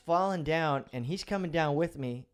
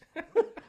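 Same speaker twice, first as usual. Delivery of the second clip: sudden high burst of laughter; real amused laugh; 8 dB louder than usual. Real, not acted. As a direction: laugh out at the absurdity. Laughs at own story.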